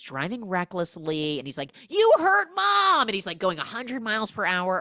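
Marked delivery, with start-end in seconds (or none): 1.9-3.1 high, loud, slow accusation; mock furious yell. Son furious with his dad, loud slow mock yell. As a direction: yell it at him slowly.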